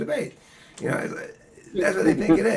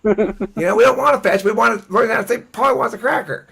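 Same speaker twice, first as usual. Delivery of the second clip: louder, higher sulky parrot; a parrot griping at school, louder and higher; 4 semitones higher, 6 dB louder than usual. Retold or imitated. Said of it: "They're like".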